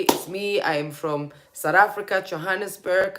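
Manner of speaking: normal talking voice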